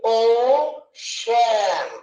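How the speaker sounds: ఓషన్ slowly split as "ఓ... షన్"; slow syllable-by-syllable ఓషన్, in two beats. Shown as a demonstration. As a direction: say ఓ, pause, then షన్. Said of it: "ఎన్ని parts అని".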